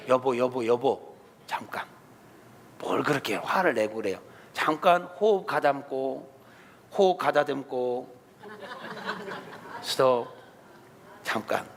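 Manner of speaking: calm your angry husband down gently; calming spouse. Acted. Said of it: "한쪽에서 말려야지". (화난 남편을 말리는 아내, 낮고 차분하게)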